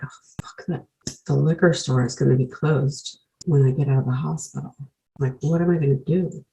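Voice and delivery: near-whispered curse, then soft complaint; quietly fretting to oneself; hushed inner worry. Retold or imitated. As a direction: say it under your breath, as a retold thought. Retold private worry about liquor.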